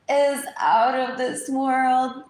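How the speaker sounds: loud, slow sarcastic punchline. Real, not acted. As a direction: drag it out sarcastically.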